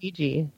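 Ouija ending in "jee"; reported pronunciation. Retold or imitated. Host passing on what Karen says, Ouija with "jee". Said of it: "Karen is saying Ouija".

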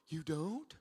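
understated incredulous question, rising sharply at the end; taken aback, voice lifting on "don't"; mock shocked. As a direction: ask it in stunned disbelief. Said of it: "you're shocked, you know".

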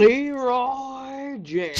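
drawn-out high battle cry; Leroy Jenkins shout. Pretend. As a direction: yell it slow like a meme.

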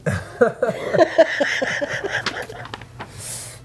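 long burst of real laughter. Real, not acted. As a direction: break up laughing hard. Laughing at the hickey joke.

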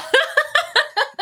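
high-pitched burst of laughter; real high laugh. Real, not acted. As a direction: crack up, high and helpless. Shocked at shot glass.

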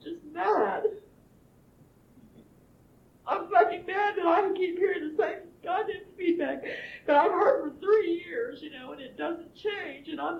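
real crying rage, voice cracking; crying angry outburst. Real, not acted. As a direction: break down shouting through tears. Emotional outburst in group session.

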